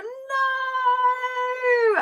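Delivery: high, drawn-out cry of no; a desperate wail of denial; screaming no. Retold or imitated. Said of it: "And I'm screaming".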